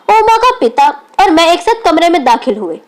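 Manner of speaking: plain reading voice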